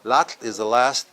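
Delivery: ordinary delivery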